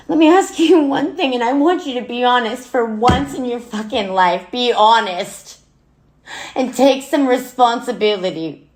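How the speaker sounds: loud, high, furious pleading; anguished demand. Acted. Daughter demanding honesty from her dead mother, anguished anger. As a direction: demand an answer at the top of the hurt.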